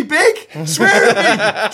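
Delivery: slow, laughing through it; giggling child begging; laughing kid voice. Retold or imitated. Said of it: "and they were going".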